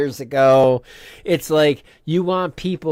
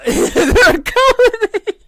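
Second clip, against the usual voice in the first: very high, loud squeal through laughter; laughing shriek; 11 dB louder than usual. Real, not acted. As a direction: crack up while drawing out the words. Cracking up over a misheard word.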